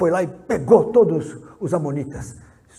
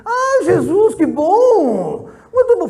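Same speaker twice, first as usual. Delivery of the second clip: much higher and louder, mock-sweet old woman; shrill old witch; 14 semitones higher, 9 dB louder, much slower than usual. Old woman greeting Rosália, high mock-sweet witch voice. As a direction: play a sugary old witch, pitched way up. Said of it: "é uma bruxa".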